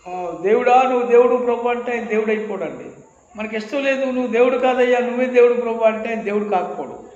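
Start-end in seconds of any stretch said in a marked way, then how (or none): 0.4-1.7 calling out louder to God, mock; a preacher aping a showy loud prayer. 4.0-5.8 calling out louder to God, mock; a preacher aping a showy loud prayer.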